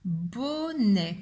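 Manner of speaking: "Bonnet" as a tighter two-beat word; measured "bo-nè", no long hold; syllables joined up. Shown as a demonstration. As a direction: recap "Bonnet" with only a short n. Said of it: "qui fait le son".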